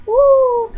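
high, loud "woo"; excited whoop. Real, not acted. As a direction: let out a long gleeful whoop. Cheering over the hat box.

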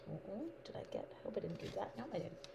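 real whisper, unvoiced and soft; worried whisper. Real, not acted. Crafter checking her card for a slip, in an anxious whisper. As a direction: breathe it out, worried you ruined it. Worried she made a mistake.

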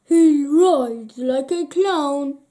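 loud, disapproving king voice; stern king. A narrator's reading. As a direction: scold like a royal father. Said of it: "said the king".

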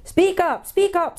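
high-pitched peevish demand; impatient deaf dwarf. A narrator's reading. Deaf dwarf demands louder speech.

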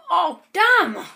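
loud, high burst of annoyance; frustrated shout. Real, not acted. Gamer cursing at a misplaced block, with a loud frustrated shout. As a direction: yell it at the screen in frustration. Block goes wrong again.

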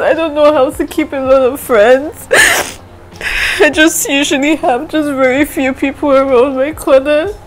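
high, tearful voice breaking into sobs; crying through it, barely holding together. Real, not acted. Vlogger tearfully telling viewers her close friend is leaving, crying. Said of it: "You guys have not seen me cry".

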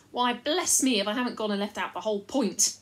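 lightly voiced rustic chief, earnest and worried; a simple country fellow pleading his case; a hint of a rough country leader.

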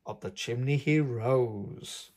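plain reading voice

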